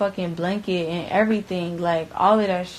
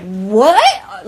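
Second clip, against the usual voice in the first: shocked what; 2 semitones higher, 7 dB louder than usual. Retold or imitated. Reacting to corpse remark.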